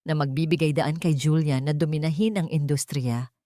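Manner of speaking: plain reading voice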